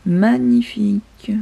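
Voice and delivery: slow, hushed whisper of awe; a whispered magnifique, slow and hushed. Real, not acted. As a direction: breathe the word out in wonder. Admiring a shiny card.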